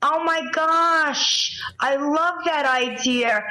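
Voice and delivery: high, slow, thrilled gushing; gushing delight. Real, not acted. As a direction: melt with delight, stretched out. Delighted by reindeer idea.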